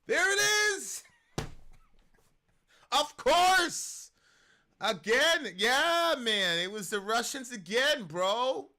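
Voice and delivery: loud, high, mock-triumphant shouting; sarcastic outburst. Real, not acted. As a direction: cheer as if the obvious lie was exposed. Mocking the fact-check headline.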